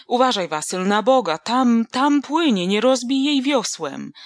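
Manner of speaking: grumble, then yell to save the bottle; indignant rant. Acted. Soaked Jemioł rants, spots bottle.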